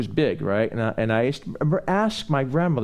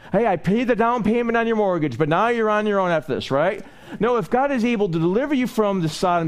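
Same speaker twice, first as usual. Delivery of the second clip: full-force preaching; 8 semitones higher than usual. Acted. Preacher urging the congregation not to linger, fervent. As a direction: drive the sermon home to the congregation.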